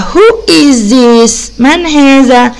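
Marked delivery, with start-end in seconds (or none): 0.0-1.3 very slow, word by word; slow model reading. Shown as a demonstration. Teacher slowly modelling the question for pupils. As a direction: spell it out slowly for the kids. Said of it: "يبقى".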